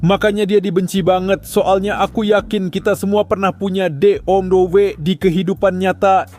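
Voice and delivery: ordinary talk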